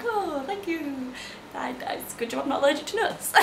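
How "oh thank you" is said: softer, slow, touched thank you; mild touched thanks; soft surprised thanks. Retold or imitated. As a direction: take the gift gently. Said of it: "And I'm like".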